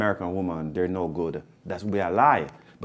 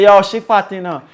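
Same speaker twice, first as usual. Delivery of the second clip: loud, high mock comment; acted Jamaican man's exclamation; imitated blunt remark; 10 semitones higher, 10 dB louder than usual. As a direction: call it out loudly in patois. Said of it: "He not did not say".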